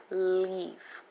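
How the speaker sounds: "Leaf" with the EE held long; long E sound. Shown as a demonstration. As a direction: stretch the vowel in "Leaf" slowly. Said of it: "as you begin to pronounce".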